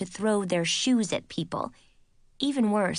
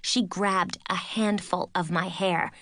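ordinary delivery

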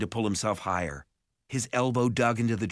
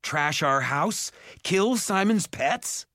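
slow, higher, mounting outrage; angry accusation; 8 semitones higher, slower than usual. A narrator's reading. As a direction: hurl each charge in indignant disbelief. Jared angrily accuses Hogsqueal.

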